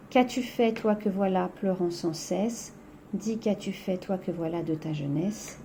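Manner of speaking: quiet, fading verse recital; soft poem reading. Acted. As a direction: read the lament softly, trailing away. Reads the poem aloud.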